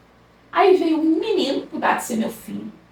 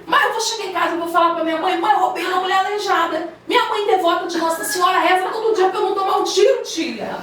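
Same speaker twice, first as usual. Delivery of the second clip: pleading robber; 6 semitones higher than usual. Retold or imitated. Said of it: "Aí ele disse assim".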